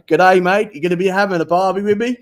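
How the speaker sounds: put-on Aussie accent. Host retells greeting his guest Brett, mock Aussie accent. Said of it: "finally use my Aussie accent".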